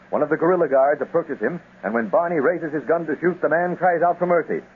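even radio serial narrator voice; story narration. A narrator's reading. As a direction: set the scene like an old radio adventure. Narrator reads the jungle scene.

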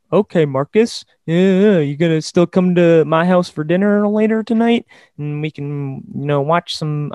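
a hint of a Southern accent, mocking a pushover coach; lightly put-on Southern accent for a meek, eager-to-please coach; terrible Brad impression. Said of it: "That was a terrible Brad impression".

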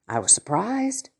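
ordinary talk, nothing marked

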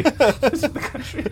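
said through a laugh, high. Real, not acted. Cohost jokes the soldier sounds country, laughing. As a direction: crack up while saying it.

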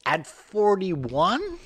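high, very drawn-out disbelief; incredulous question. Real, not acted. Host pressing co-host on the player's age, incredulous. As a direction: stretch it out and swing up, disbelieving. Repeats the challenge after co-host disagrees.

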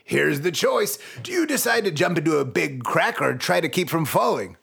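ordinary delivery

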